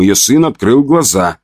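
ordinary delivery

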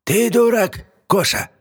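put-on high shrill old witch scolding. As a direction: scold him like a cross old hag. Yaga scolds, hits him.

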